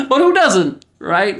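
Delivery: high-pitched, jokey protest; mock indignant squeal. Clowning defensive question to viewers.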